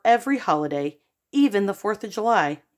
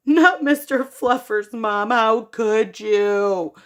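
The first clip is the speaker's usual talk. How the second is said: dramatic wail; 3 semitones higher, 4 dB louder, slower than usual. Acted. Boy begging mom to spare his bunny, melodramatic. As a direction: wail over the bunny slowly.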